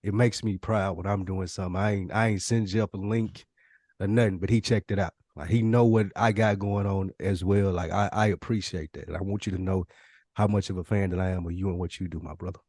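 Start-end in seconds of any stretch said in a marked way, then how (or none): none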